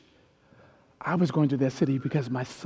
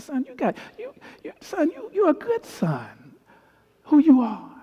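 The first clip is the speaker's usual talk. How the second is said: emotional father; 11 semitones higher, 5 dB louder than usual. Retold or imitated. Father affirming son, voice cracking.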